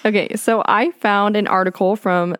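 normal talking voice

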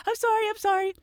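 hammed-up nervous begging; mock scared apology. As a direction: grovel to the officer. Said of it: "I act so scared and nervous".

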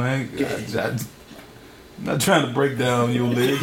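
ordinary talk

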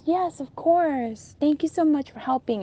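put-on sweet, lilting thank-you; sugary thanks. Aunt's pretend affectionate thanks.

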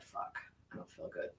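breathless whisper, almost no voice; faint whisper. Retold or imitated. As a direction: whisper it like you're about to faint. About to pass out in line.

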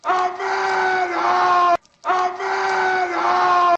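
high drawn-out shout; shouted jingle. Acted. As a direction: yell it slow and high.

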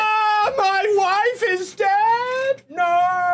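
high crying wail. Acted. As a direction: wail in high, loud grief. Noble learns wife died.

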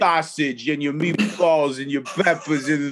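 slow mobster-movie impression; drawn-out film mafioso voice; Clemenza. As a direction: imitate an old gangster teaching a recipe. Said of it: "The scene where Clemenza is teaching".

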